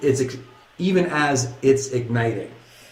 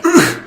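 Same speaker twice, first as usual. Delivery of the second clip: high, loud "ugh" grunt; pained groan; 7 dB louder than usual. Retold or imitated. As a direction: grunt like you've been knocked down. Crowd groaning again.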